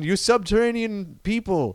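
high, slow otherworldly decree; booming arrogant alien overlord. Acted. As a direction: talk down to the natives. Alien addressing subway dwellers.

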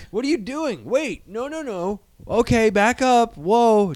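high alarmed pleading; mock panic. Host pretends to stop the studio, high panicky.

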